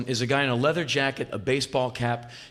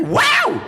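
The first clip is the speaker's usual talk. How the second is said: very high, loud yelp; rock-singer whoop; 7 dB louder than usual. Retold or imitated. Rock singer capping his boast, high loud yelp. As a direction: yelp like a showboating frontman.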